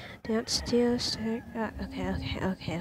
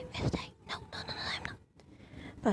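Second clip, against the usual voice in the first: whispered fear; 6 dB quieter, faster than usual. Real, not acted.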